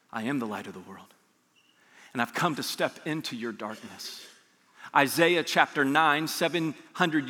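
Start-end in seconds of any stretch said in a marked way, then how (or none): 0.1-4.2 much softer and lower, intimate; almost confide it to the listener; hushed and tender. Acted. Pastor speaking Jesus' promise to the weary, hushed and tender.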